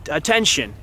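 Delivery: ordinary delivery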